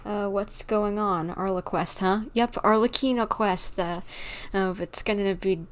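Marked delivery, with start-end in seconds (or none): none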